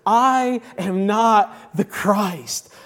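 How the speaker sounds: drawn-out, weighty stress on "I" and "not"; emphatic declaration. Retold or imitated. Preacher quoting John's denial to the congregation, emphatic. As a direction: declare it very slowly, leaning on "I". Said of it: "he emphatically declares".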